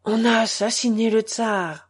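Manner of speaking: altered, stricken announcement; changed voice. A narrator's reading. Patron back from the street with grave news, voice changed. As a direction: deliver the news in a voice not your own. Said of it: "il dit d'une voix changée".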